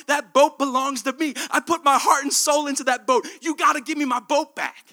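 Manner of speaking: loud, high desperate pleading; a boy shouting his claim to the store owner. Acted. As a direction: beg the owner to hand it back.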